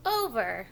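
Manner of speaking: slow clear model word "Over"; modelled word. Shown as a demonstration. Preschool teacher modelling "Over" for the child to repeat. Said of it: "You try".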